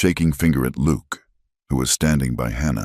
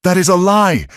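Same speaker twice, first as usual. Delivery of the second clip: high loud furious scream; outraged high scream; 6 dB louder than usual. A narrator's reading. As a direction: scream the denial. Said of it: "Hannah screamed".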